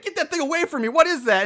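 high-pitched pretend revulsion; hammed-up freaked-out demand; mock alarm. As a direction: act out recoiling from something gross. Acts out recoiling from the bug.

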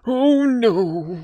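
panicked oh no. Acted. Players voicing panicked old man.

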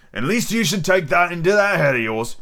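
lightly acted gruff-spoken pirate leader; a hint of a pirate captain; lightly voiced Long John Silver. Pirate leader advising the captive boy, lightly acted seadog voice.

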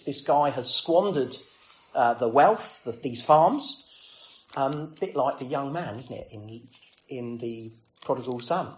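normal talking voice

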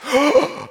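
acted crowd gasp; sharp gasp of horror, imitated; shocked gasp. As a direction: suck in air as a stunned onlooker. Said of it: "for the Philistines gasp".

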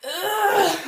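high straining ugh; effortful grunt. Retold or imitated. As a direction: grunt pulling hard. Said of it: "You're like".

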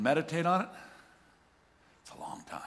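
hushed whisper. Real, not acted. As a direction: whisper it as if eternity weighs on you. Preacher drops to whisper on eternity.

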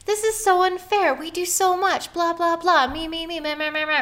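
high, mock-whiny griping, trailing into meh meh; whiny complaining. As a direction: pout and whine like a sulking child. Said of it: "I just kinda started complaining".

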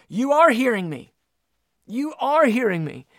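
high, forceful "You are hearing me"; emphatic affirmation. Acted. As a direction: raise pitch and punch "are hearing" with conviction. Voicing God's emphatic affirmation.

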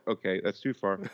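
normal talking voice

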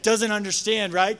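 usual speaking voice

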